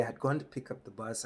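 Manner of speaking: ordinary delivery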